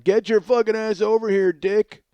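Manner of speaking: hammed-up angry summons; mock yell. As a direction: shout it like a bossy order. Mocking Lily's demand.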